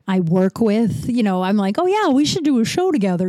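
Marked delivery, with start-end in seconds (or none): 1.8-3.3 mock-eager, higher pitched acted quote; eager people-pleaser. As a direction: lift it up high in sarcastic keenness. Mocks her former eager people-pleasing self.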